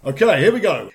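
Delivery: ordinary delivery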